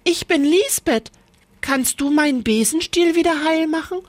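high, eager little witch; bright asking girl, quick; Lisbeth. A narrator's reading. Lisbeth asks the witch to fix her broom, high and quick.

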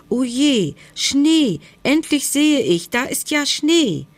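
high, thrilled exclamations; excited discovery. A narrator's reading. Hedgehog finally seeing snow, high and excited. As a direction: burst out with delight, pitched well up.

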